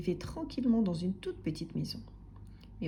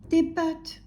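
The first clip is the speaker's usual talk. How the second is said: slightly raised, higher little voice; trying to speak up; 7 semitones higher, 4 dB louder than usual. A narrator's reading. Timid customer trying again, raising his voice. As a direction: strain to be heard, still small. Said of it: "essayait d'élever la voix".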